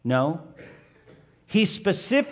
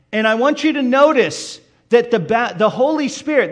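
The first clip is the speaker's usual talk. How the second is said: rising, louder preaching voice; 3 semitones higher, 6 dB louder than usual. Acted. Pastor to congregation, preaching the baptism with rising force. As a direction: preach the heavens opening with building force. Preacher stresses approval and empowerment.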